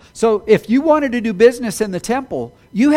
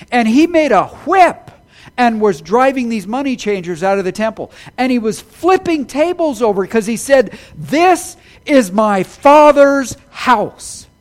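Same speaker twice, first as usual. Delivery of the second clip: forceful proclamation; 4 semitones higher, 6 dB louder than usual. Retold or imitated. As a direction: thunder it out like a prophet. Preacher voicing an angry Jesus.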